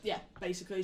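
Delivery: ordinary talk, nothing marked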